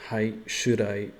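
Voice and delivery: slow, loud learner Japanese; carefully voiced Japanese answer; japanese learner accent. Shown as a demonstration. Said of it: "I wonder if I should just say".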